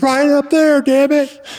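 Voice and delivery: high, loud snap; angry Paul. Retold or imitated. Paul directing the driver through traffic, high angry snap. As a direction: spit out the order in a squeak.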